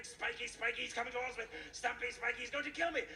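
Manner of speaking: hammed-up fear as the rhino charges; mock panic.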